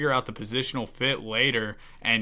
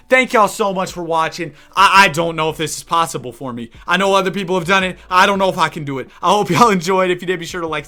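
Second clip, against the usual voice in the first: energetic outro; 6 semitones higher, 9 dB louder, faster than usual. Real, not acted. Sign-off to viewers.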